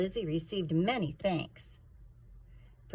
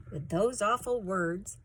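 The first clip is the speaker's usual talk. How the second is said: ordinary delivery, nothing marked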